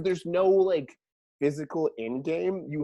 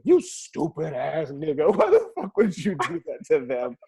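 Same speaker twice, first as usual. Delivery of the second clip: furious mom; 4 dB louder than usual. Retold or imitated. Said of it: "she was so furious".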